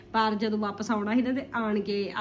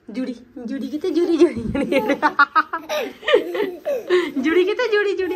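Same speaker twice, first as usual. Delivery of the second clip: dramatic loud aunty; 8 semitones higher, 8 dB louder than usual. Real, not acted. Playing with baby, calling.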